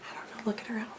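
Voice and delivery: put-on hushed aside to the children; whisper. Shown as a demonstration. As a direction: whisper it like sharing a secret. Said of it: "storyteller aside to listener".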